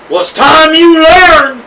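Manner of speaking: bully's shout. A narrator's reading. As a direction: yell it down at her, gloating. Bully dangling girl over bridge.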